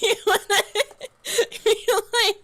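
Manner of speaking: high, breaking with laughter; giggling high fragments; laughing. Real, not acted. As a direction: laugh through the words.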